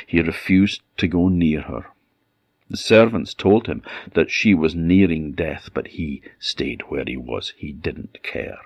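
ordinary delivery, nothing marked

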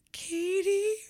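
put-on a small girl calls her nanny's name, way up high; little girl calling. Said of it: "and then she was like".

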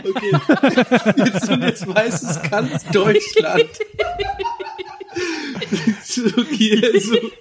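laughing too hard to breathe, high and slow; helpless giggling fit, gasping between words; breathless laughter. Real, not acted. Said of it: "krieg keine Luft mehr".

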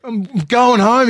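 high, loud panicked yell; retold yell. Rattled man yelling at friend in car, high loud panic. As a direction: yell him away, rattled and scared. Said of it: "I said".

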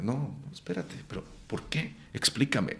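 soft, calming mock plea; hushed, acted request to explain; bringing it down. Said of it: "entonces ya la bajaba".

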